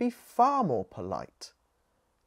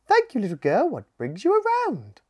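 high, light bird voice; a grateful little bird, bright and high; hummingbird voice; 7 semitones higher, 6 dB louder than usual. Acted. Hummingbird thanking Scout for her drink, high and chirpy.

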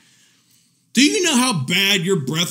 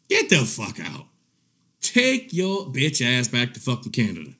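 low, mock-cold dismissal; low-pitched imitation of a brush-off; imitating dismissive husband; 7 semitones lower, 5 dB quieter than usual. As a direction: drop low to send her packing, mocking him. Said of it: "he'll be like".

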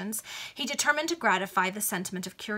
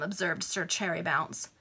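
ordinary delivery

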